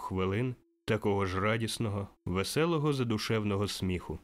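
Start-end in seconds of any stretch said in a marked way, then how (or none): none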